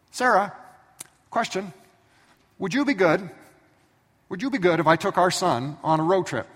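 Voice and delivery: high, loud sheepish sweet-talk; husband easing into a big ask; coaxing husband. Retold or imitated. Abraham sweet-talking wife Sarah.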